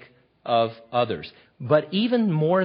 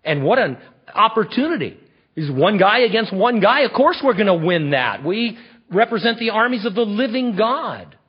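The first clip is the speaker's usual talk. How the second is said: rising louder and higher with zeal; eager indignant David; 6 semitones higher, 3 dB louder than usual. Retold or imitated. David urging the troops, building into fervor. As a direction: sell the soldiers on the fight. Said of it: "David's like".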